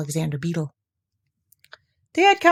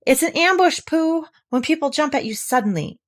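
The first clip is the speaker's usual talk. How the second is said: eager Piglet; 9 semitones higher, 3 dB louder than usual. A narrator's reading.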